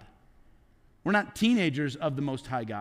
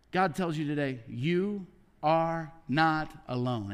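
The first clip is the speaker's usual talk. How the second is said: reassuring; 3 semitones lower, slower than usual. Real, not acted. Pastor reassures the congregation.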